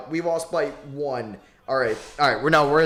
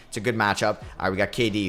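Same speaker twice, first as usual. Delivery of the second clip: ordinary talk, nothing marked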